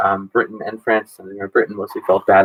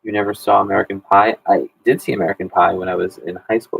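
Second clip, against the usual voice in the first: ordinary talk, nothing marked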